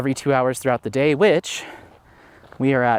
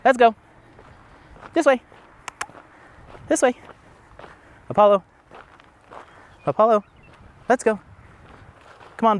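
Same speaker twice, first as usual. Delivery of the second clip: high, loud repeated calls; calling the dog; 9 semitones higher, 4 dB louder, faster than usual. Real, not acted. Owner calling her dog along the trail, loud and high. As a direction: keep calling him along.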